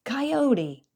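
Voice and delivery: high, loud bold shout; boy howling with false courage; brave howl. A narrator's reading. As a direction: yell it out bravely. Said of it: "howled Master in a brave voice".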